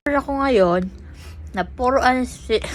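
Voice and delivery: numb mouth after anesthesia; talking with a numb mouth; numb from the dentist. Real, not acted. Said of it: "puro anesthesia ako".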